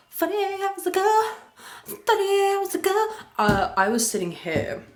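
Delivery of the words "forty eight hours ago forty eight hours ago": squeaky, loud playful repeat; silly high voice. Pretend. Correcting the timeline on a vlog, silly high voice. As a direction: pipe it up high, playing around.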